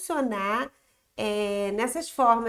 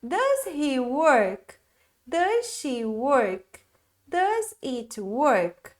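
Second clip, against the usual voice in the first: correct model question; much slower than usual. Shown as a demonstration. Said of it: "pay attention here".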